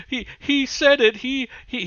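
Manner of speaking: high, silly voice mocking Wally; squeaky mockery of a rival. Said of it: "he's gonna say".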